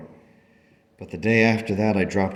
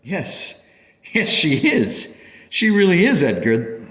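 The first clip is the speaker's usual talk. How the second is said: high, emphatic joyful outburst; elated; 9 semitones higher, 5 dB louder than usual. Acted. Parent telling Edgar the daughter is cancer-free, elated. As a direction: share wonderful news, overflowing.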